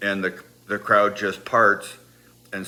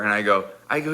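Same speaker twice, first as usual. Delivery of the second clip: ordinary talk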